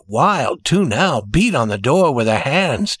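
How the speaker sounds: ordinary delivery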